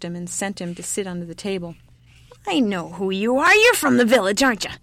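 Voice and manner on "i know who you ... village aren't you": put-on loud, swelling old-woman accusation; suspicious old woman. A narrator's reading. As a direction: challenge the visitor, rising as you recognize him. Old woman accuses her visitor.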